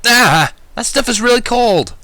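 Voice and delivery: high, loud startled whine; whining yelp. Acted. Narrator yelping as cold slime hits his back. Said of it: "I whined".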